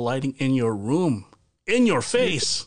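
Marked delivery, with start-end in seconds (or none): 1.7-2.7 high, slow teasing taunt; playful taunt. Acted. Host teasing co-host about his lighting, playful taunt. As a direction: rub it in, playfully.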